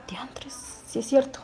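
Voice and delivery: hushed whisper, slow; breathy aside to herself; whispered realization. Retold or imitated. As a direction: whisper it as the penny drops. Realizing her own mistake.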